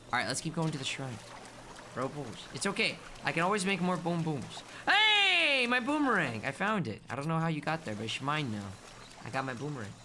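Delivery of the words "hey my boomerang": high, loud burst of excitement; delighted cry. Real, not acted. Player finding the lost boomerang, delighted cry.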